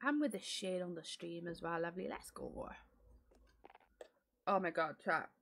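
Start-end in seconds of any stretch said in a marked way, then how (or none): none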